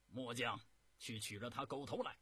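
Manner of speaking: volunteer boldly, full of confidence; very confident. A narrator's reading. General Pan Jun volunteering to his emperor, very confident. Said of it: "非常自信地说".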